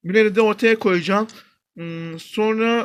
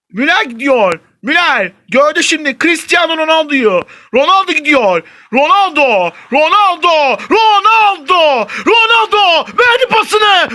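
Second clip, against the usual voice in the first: rising play-by-play call, louder to a shout; mock commentator build-up; 7 semitones higher, 7 dB louder, slower than usual. Gamer calling his attack as a commentator, building to a shout.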